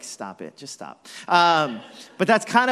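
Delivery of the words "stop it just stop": low, soft, deadpan hush; a wife quietly telling him to cut it out; wife shushing me. Retold or imitated. Wife hushing her celeb-chasing husband, low and soft. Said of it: "then my wife's like".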